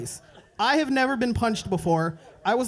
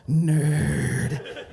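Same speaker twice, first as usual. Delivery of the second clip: bully growl; 6 semitones lower than usual. Retold or imitated. Bully spotting him, voiced.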